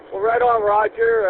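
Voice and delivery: excited. Real, not acted. Cheerful radio acknowledgement.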